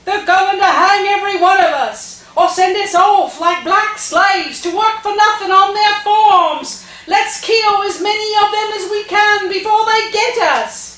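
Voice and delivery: high, loud rabble-rousing shout; yelling at crowd. Retold or imitated. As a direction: imitate a man rousing a mob from a lamppost. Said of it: "starts yelling at the crowd".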